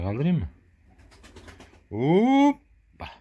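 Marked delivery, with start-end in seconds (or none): 1.9-3.1 high, loud hop; playful shout. Pretend. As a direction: cheer as you lift it. Lifting the baby animal up.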